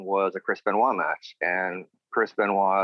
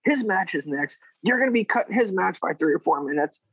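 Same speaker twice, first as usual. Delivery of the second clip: high, raised voice of a lecturing boss; scolding Arn; 9 semitones higher than usual. Retold or imitated. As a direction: play the boss chewing him out. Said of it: "he's like".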